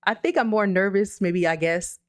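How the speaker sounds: ordinary talk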